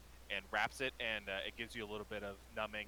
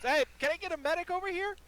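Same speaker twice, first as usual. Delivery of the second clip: loud, much higher call across the street; shouting for a medic; 11 dB louder than usual. Acted. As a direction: yell it out to be heard far off. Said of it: "she just shouts".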